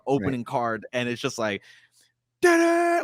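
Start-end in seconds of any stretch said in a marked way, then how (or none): 2.4-3.0 high, loud "da-da" imitating a movie sting; loud, aggressive title sting. Imitating the title card slamming in for co-host, loud and aggressive. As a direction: blare out a sudden horror title-card sting. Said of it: "like, very loud and aggressive".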